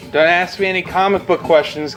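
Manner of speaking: usual speaking voice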